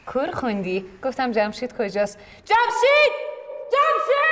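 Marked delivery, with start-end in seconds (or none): 2.5-4.3 loud, high calls across the hospital hall; shouting a name. Acted. Wife shouting for her husband in the hospital hallway, loud call. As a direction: yell the name to find him. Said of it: "لطفاً آرامتر".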